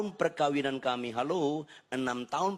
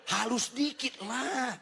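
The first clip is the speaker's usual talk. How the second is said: high, drawn-out whiny complaint; mock pleading protest; 11 semitones higher, slower than usual. Pastor objecting to a rude seller, mock-wounded protest.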